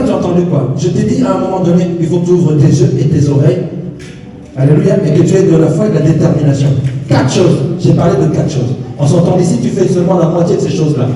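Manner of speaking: normal talking voice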